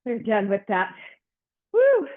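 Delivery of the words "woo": high loud whoop; excited woo. Real, not acted. Cheer after finishing wall sit.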